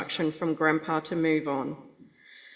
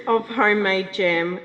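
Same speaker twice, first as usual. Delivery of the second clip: real laugh breaking into tears; laughing through the wobble; 6 semitones higher, 4 dB louder than usual. Real, not acted. Speaker losing her place at the eulogy, laughing near tears.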